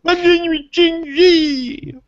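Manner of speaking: high, slow, squealing mock little-girl scream. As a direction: shriek it like a scared little girl. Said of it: "he screams it like a little girl".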